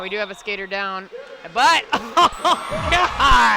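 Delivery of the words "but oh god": loud laughing gasp; laughing exclaim. Real, not acted. Commentator cracking up as the skater gets back up, laughing. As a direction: laugh it out in delight.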